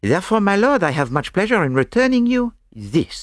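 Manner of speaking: put-on high-pitched, French-accented flourish; ceremonious Belgian detective. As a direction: present it with courtly relish.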